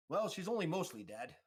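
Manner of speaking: high, loud, chirpy diagnosis; offhand medic character. Acted. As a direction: pitch it up, breezily unconcerned. Voices the medic over patient.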